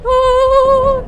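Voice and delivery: long, loud, high frightened "ooh"; gemido de miedo. Real, not acted. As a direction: let out a high wail of fear. Frightened reaction while fleeing. (ulular agudo y asustado)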